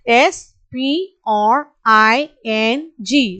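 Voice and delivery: put-on spaced-out letters for children to copy; slow letter by letter. Shown as a demonstration. Teacher spelling slowly to children to repeat. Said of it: "listen carefully and repeat along with me".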